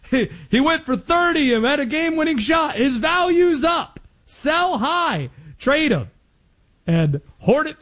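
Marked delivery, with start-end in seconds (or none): 0.1-6.1 higher, fast hype pitch, a shouted peak; high-pitched mock excitement, cashing in; excited salesman. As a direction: sell it like a giddy trader. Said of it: "my initial reaction was".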